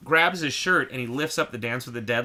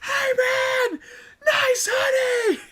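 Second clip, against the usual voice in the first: high, loud yell across lanes; shouting over traffic; 7 dB louder, slower than usual. Retold or imitated. Yelling from the car at the van driver on the freeway. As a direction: holler it out the car window. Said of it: "we're screaming back and forth".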